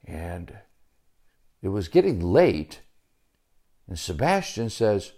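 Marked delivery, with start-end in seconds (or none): none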